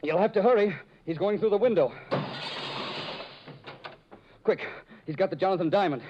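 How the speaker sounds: high, loud hurried calls; urgent alarm. Acted. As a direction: raise the alarm, he's getting away. Urgent alarm as thief escapes.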